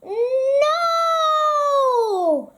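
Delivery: high, sharp startled scream. Acted. Badger waking from a nightmare about Mole, high scream. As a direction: jolt awake crying out. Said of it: "screamed Grumpy Badger".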